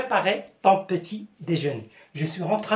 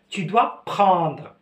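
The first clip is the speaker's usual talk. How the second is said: slow teaching demo; much slower than usual. Shown as a demonstration. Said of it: "teacher drills the phrase".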